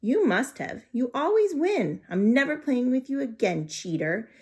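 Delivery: put-on loud angry girl's shout. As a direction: yell it at her best friend. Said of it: "Gail shouted".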